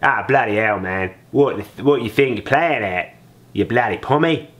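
mock Australian bloke picking a fight; hammed-up angry Australian accent. Shown as a demonstration. Dad acting as an angry Australian threatening a pom. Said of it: "There you go. Nailed it".

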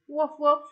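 put-on playful woof for the kids; dog woof. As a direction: bark it like a puppy. Dog sound in story.

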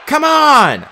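high, loud rallying yell; urgent battle yell; shout. A narrator's reading. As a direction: shout to urge the others on. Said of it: "I shouted".

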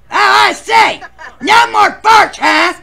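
high, loud indignant yell; shouting. Acted. Yelling at the room about farting. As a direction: bellow it out in protest.

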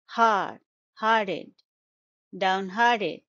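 "downhearted" built up from its stressed middle, HART; second-syllable stress. Shown as a demonstration. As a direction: punch "hard", then carry it into down-HART-ed. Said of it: "Stress is on the second syllable".

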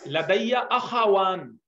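لدی اخوان drawn out very slowly, each syllable clear; slow pronunciation demo. Shown as a demonstration. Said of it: "دیکھیں pronouns صحیح کریں". (آہستہ آہستہ تلفظ: لدی اخوان)

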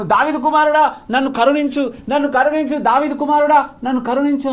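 loud, high cry for mercy; shouted plea. Retold or imitated. Blind beggar calling to the passing Jesus over the crowd, loud high cry for mercy. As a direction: call out over the crowd, begging. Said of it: "నేను గట్టిగా అరిచాను".

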